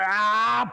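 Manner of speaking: high, effortful pulling yell; straining yell. Acted. Paula hauling Saf up the edge, straining yell. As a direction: strain as you haul someone up.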